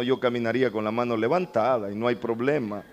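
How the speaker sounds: normal talking voice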